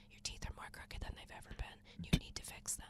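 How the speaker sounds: hushed nagging whisper. Retold or imitated. Inner voice quoted as whisper.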